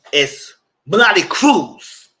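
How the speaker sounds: hammed-up Melody Cruz; imitating Melody. Copying how Melody announced her name, loud slow mock. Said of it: "that's how she said it like that".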